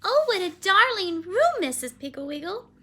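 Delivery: high, loud, gushing exclamation; delighted little girl. Acted. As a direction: gush over it like a delighted child. Child delighted with her room.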